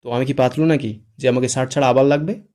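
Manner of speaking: lightly voiced irritation; irritated voice. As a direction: snap it back with a touch of annoyance. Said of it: "বিরক্ত কণ্ঠে বলে".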